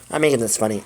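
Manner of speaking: ordinary talk, nothing marked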